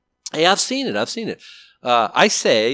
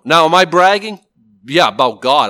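much louder, punching the question out; emphatic; 10 dB louder than usual. Real, not acted. Preacher to the men, a loud emphatic aside. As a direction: bang out the question and answer.

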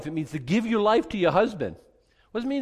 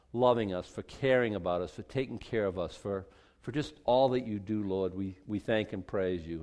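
low, subdued praying voice; closing prayer; 7 semitones lower, 3 dB quieter than usual. Real, not acted. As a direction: pray aloud in a lowered, measured voice. Let's stand, let's pray.

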